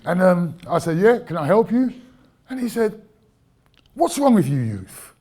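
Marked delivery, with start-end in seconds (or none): 1.0-1.9 high, loud, pointed door greeting; mock-polite loud challenge; sharp retold greeting. 3.9-5.1 louder, brusque imitation of a councillor; mock-officious demand; retold rude councillor. Quoting councillor's rude question.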